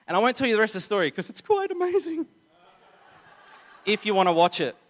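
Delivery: high, fast playful tease, laughing through it; giggly coy refusal to give away the ending. Real, not acted. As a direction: grin and hold back the spoiler. Teasing refusal to spoil ending.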